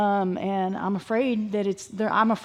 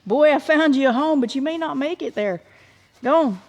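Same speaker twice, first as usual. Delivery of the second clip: raised, higher shooing voice; loud scolding; 6 semitones higher, 6 dB louder than usual. Real, not acted. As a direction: shoo the animal off loudly. Scolding an animal away.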